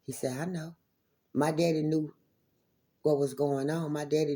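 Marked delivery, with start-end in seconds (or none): 0.4-0.8 quiet, knowing answer; lightly imitating a soft father; father quietly agreeing with his daughter. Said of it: "never heard my daddy talk in a soft voice".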